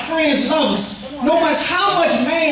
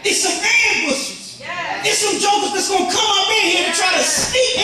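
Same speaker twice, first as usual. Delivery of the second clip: preaching at full force; 6 semitones higher than usual. Acted. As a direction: rouse the congregation, voice climbing and ringing.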